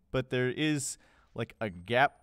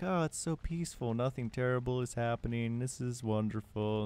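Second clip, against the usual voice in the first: soft, unhurried contented sigh; softly put-on calm; mock serenity; 6 dB quieter than usual. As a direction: play it blissfully peaceful and quiet. Acting calm before disaster.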